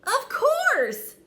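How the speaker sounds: very high, loud exclamation; a bright realization; delighted knight. Acted.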